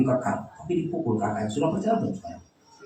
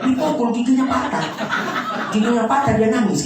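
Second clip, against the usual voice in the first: preaching; 13 semitones higher, 6 dB louder than usual. Acted. Preacher retells loudly.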